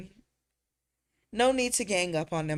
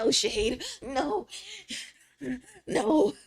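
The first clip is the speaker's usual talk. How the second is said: mock protest; 10 semitones higher, 3 dB louder, slower than usual. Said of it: "And I'm like".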